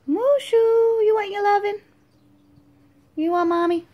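high, fast sing-song cooing at a pet. Real, not acted. Mom coos to her dragon Mushu in high baby talk.

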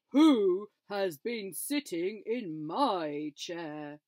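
slow, loud booming papa bear; big bear, slow and loud; Father Bear. A narrator's reading. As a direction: drag it out big and cross. Father Bear voiced in story.

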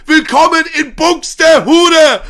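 slow, loud, booming welcome; grand shout. A narrator's reading. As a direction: call it out like a showman. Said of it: "rief Monte".

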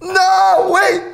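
high, loud cry of dismay; alarmed protest. Real, not acted. Host protesting the wheel's result, high alarmed shout. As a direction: plead in alarm as it lands.

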